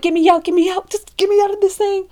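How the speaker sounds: high, loud panicked plea; frantic begging. Retold or imitated. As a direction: re-enact desperate demand to escape. Acts out begging to leave ride.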